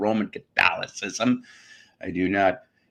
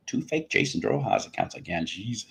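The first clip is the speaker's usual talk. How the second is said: ordinary talk, nothing marked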